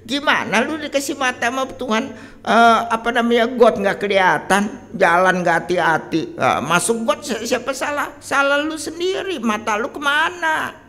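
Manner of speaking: mock scolding. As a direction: berate them like an exasperated parent. Scolding someone who fell in the gutter. (omelan menyalahkan, suara tinggi dan keras)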